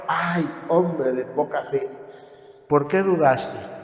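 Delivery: slow, soft, gentle scolding; tender rebuke. Retold or imitated. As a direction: chide him lovingly while holding him. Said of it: "Jesús pudo haber agarrado a Pedro".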